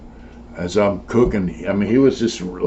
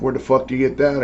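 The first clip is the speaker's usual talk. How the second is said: quick, higher-pitched retold outrage; mock indignation; 5 semitones higher, faster than usual. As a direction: imitate her mildly angry, hurried question. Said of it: "She was mad".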